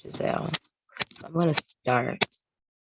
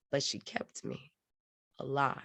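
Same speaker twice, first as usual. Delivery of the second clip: hushed ending; 11 dB quieter than usual. Acted. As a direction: drop to a quiet, sincere close. Poem's soft, tender closing line.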